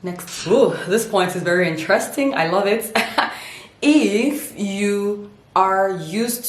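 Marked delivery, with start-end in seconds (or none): none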